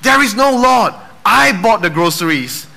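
high, loud gloating yell; neighbor's shout. Acted. Neighbor jumping from the bushes to shout at her. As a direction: jump out and shout him down.

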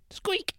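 tiny very high squeaky-toy noise; imitated squeak. Teddy bear gets hit with his bat, high squeak. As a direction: squeak it like a toy being squeezed.

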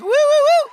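high, loud rising whoop; cheering woo. Shown as a demonstration. Host asks crowd to welcome Rachel and David, big woo.

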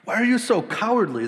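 stern rebuke. Retold or imitated. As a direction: challenge them sharply.

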